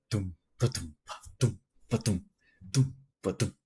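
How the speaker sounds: low vocal drum beat, dum ba-dum; rhythmic spoken bass-drum pattern; imitated Brazilian beat. Shown as a demonstration. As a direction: mouth the percussion rhythm. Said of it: "it's like a specific beat".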